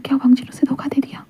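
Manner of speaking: soft, barely voiced question to himself; a soft inner whisper of betrayal; hushed stunned thought. A narrator's reading. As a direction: whisper the doubt as if afraid to say it aloud.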